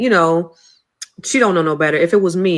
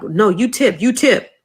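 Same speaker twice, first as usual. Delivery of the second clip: loud, insistent retold demand; imitated demanding manager; 4 semitones higher, 8 dB louder than usual. Manager ordering the daughter to tip, imitated insistence. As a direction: push the order harder the second time.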